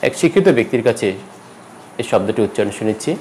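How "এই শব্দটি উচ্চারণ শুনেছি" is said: ordinary talk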